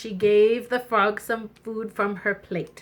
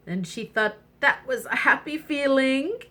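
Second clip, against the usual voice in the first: plain reading voice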